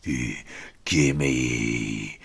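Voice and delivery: a lightly voiced thug; bully voice. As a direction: growl it like a playground bully. Bully demands food.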